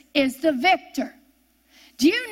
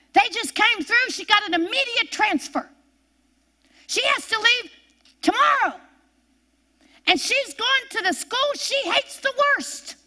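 excited news; 6 semitones higher than usual. Retold or imitated. Quoting coworkers with news.

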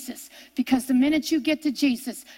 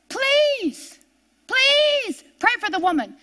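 pleading; 9 semitones higher, slower than usual. Retold or imitated. Family chases Smith, begging.

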